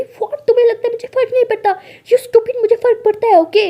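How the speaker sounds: very high, loud insistence; indignant outburst. Acted. Alia insists to her doubting partner, high and indignant. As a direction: shout back that you do care.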